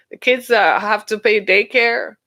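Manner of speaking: normal talking voice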